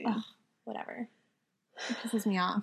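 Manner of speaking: annoyed ugh. Real, not acted. Annoyed about the missing costume.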